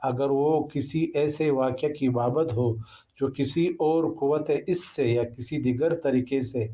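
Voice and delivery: ordinary talk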